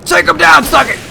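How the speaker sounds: high loud battle cry; battle shout. Real, not acted. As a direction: shout him down. Fighting the boss.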